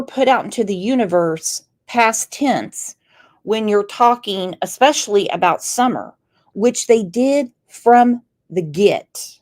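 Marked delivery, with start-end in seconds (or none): none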